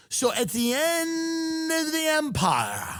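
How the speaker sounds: high, long drawn-out declaiming; mock-grandiose proclamation. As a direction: stretch it out slowly like a grand pronouncement. Mock oratory closing the rant.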